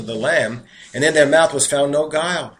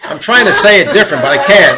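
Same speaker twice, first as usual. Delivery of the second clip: loud, high, laughing helplessly; laughing protest; 6 semitones higher, 11 dB louder than usual. Real, not acted. Preacher laughing at failing to hide the accent, amused protest. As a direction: own up to it through real laughter.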